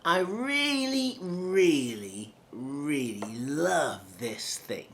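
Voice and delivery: slow, stretched really, really, really; extended, emotive. Shown as a demonstration. Coach showing emotive extended words, slow. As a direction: linger on each really with feeling. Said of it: "learn how to extend your words".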